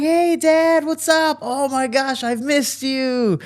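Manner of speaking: very high, gushing mock sweetness; hammed-up, high-pitched adoring kid; over lovey-dovey. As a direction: lay the affection on way too thick. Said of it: "I'm, like, over lovey-dovey".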